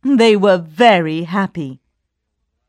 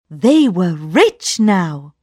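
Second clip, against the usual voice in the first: usual speaking voice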